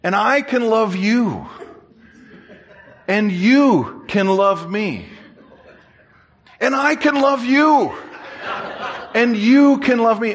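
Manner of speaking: slow, singsong declarations, climbing in pitch; imitated insistent pastor. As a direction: repeat it with swelling earnestness. Pastor's repeated line, building.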